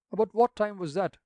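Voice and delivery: a rector demanding an answer; severe. A narrator's reading. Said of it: "with severity".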